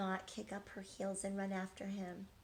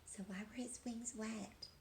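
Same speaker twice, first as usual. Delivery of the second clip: whisper; 8 dB quieter than usual. Real, not acted. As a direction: whisper it to the listeners. Teller asks listeners.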